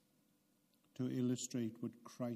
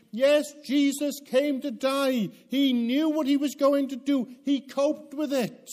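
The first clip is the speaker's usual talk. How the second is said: imitating complacent believers; 13 semitones higher, 14 dB louder than usual. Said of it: "we read those verses and we think".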